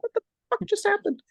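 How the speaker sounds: very high, stunned exclamation; high incredulous cry of bewilderment; shocked disbelief. Retold or imitated. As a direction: squeak it out in total shock. Retells shock after the vision.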